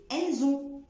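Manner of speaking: elles ont linked with a z, el-z-ont; liaison elles ont. Shown as a demonstration. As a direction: carry a z into ont. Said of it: "ما بقولش". (ربط elles ont بصوت z)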